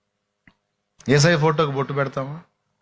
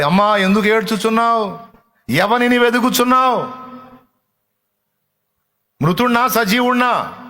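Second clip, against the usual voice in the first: raised questioning; 7 semitones higher, 3 dB louder than usual. Retold or imitated. Jesus questions weeping Mary, preached aloud.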